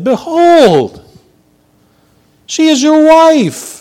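high, loud, slow outraged rebuke; stretched-out indignant Behold; indignant king. Acted. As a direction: scold him as a shocked king. Abimelech confronts Isaac, acted.

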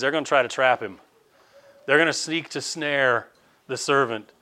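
ordinary talk, nothing marked

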